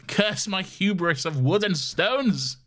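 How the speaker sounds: high, slow, melodramatic curse; mock villain lament. Co-host playing the thwarted cowboy robot, grandiose cursing. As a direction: draw out the theatrical anguish.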